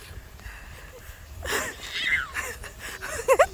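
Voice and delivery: high breathy laughing squeal; breathless scared laugh. Real, not acted. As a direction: laugh in a panic.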